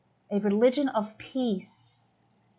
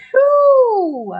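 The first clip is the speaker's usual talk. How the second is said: loud emotional whew; 11 semitones higher, 10 dB louder than usual. Real, not acted. Overwhelmed after the performance.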